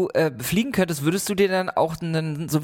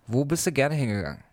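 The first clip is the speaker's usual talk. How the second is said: ordinary talk, nothing marked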